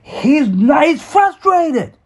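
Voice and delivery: high, slow pleading mother's voice; mother shielding her son from the stepfather, pleading high. Retold or imitated. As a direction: beg him to back off, stretching each word. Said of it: "she's like".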